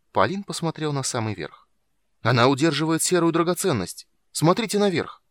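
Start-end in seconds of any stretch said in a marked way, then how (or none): 2.2-5.2 excited. A narrator's reading.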